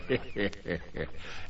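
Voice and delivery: low, quiet gloating cackle; soft villainous heh-heh-heh; evil giant's chuckle. Acted. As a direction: chuckle wickedly under your breath. Giant gloats over his supper.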